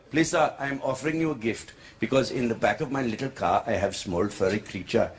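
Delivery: put-on low, pleading Indian English accent. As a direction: make a solemn offer in an Indian accent. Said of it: "Indian guy in Dubai".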